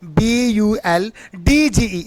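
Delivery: ordinary talk, nothing marked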